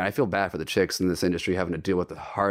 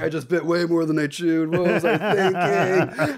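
laughing in disbelief; 6 semitones higher, slower than usual. Retold or imitated. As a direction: laugh through the words. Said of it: "They were like".

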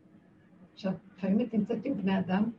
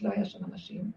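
normal talking voice

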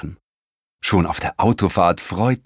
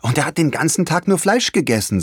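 put-on eager boy; 6 semitones higher than usual. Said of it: "sagte Leon".

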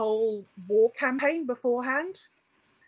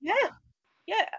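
usual speaking voice

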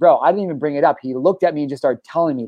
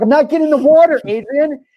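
put-on much higher, louder, childish whining protest; whiny mock yell; 12 semitones higher, 5 dB louder than usual. Shouting at his friend who walks ahead into the creek, higher and louder in a childish whine. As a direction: squeal it like a kid refusing a bath.

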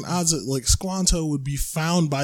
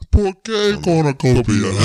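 laughing mock Spanish; 5 dB louder than usual.

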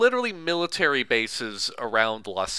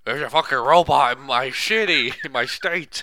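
ranting governor; 2 semitones higher, 6 dB louder than usual. Retold or imitated.

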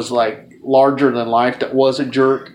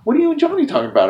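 quick high curious; 13 semitones higher, much faster than usual. Retold or imitated. Manager asks eagerly.

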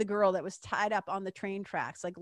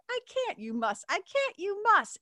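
quick back and forth, damsel lines much higher; damsel and villain; 11 semitones higher than usual. Acted. Narrator flips between damsel and villain arguing rent. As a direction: trade the lines fast between the two cartoon figures. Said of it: "it would go back and forth".

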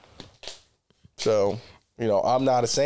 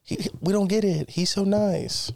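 lightly raised, slow gushing voice; mocking onlookers; 6 semitones higher than usual. Mimicking what people said about him, lightly gushing. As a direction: ham up the admiring neighbours. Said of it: "Everybody thought I was so cool".

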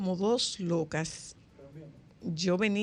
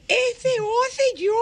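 high, loud mock child's wail; crying little boy; 8 dB louder than usual. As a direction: wail like a lost toddler calling his name. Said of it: "Y él decía". (llanto de niño pequeño exagerado)

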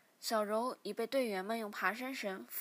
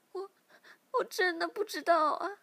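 weak tearful denial; 8 semitones higher, 6 dB louder than usual. A narrator's reading. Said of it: "但还是无力地说".

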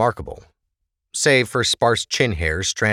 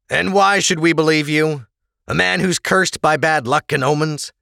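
lightly voiced stern officer, raised; 8 semitones higher, 4 dB louder than usual. Officer scolding the prisoner, lightly stern. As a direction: lay down the law to him.

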